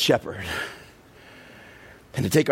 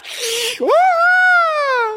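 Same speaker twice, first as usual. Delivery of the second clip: loud cackling laugh; 5 dB louder than usual. Real, not acted. Big shouted laugh.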